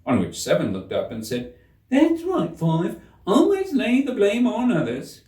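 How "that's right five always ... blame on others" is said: much higher, louder squeaky-pitched card gardener; high-pitched mocking gardener. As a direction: pitch Seven way up and a bit louder, reproachful. Seven answers Five.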